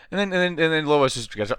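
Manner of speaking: ordinary talk, nothing marked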